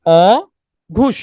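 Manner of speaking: অঘুষ stretched out very slowly as a punchline; অঘুষ held long and slow, savoring the joke; drawn-out pun. Shown as a demonstration. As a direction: drag অঘুষ out slowly so the bribe pun lands. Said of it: "তারা ঘুষ খায় না".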